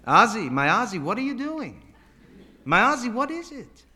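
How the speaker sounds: high-pitched, anxious calling; worried mother's high plea; bewildered mother. Acted. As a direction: call up to your child in dismay. Bewildered mother calls to son.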